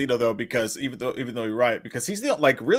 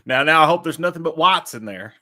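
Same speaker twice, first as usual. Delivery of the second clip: mock Southern diner grumble; lightly hammed-up Southern accent; put-on Southern accent; 4 dB louder than usual. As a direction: play a folksy Southern customer. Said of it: "we could go off and talk like that".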